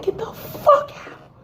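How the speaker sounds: high-pitched, drawn-out angry order; retold spazzing outburst. Woman retells throwing him out, spazzing. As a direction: kick them out, voice shooting up and stretching. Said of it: "So I'm spazzing".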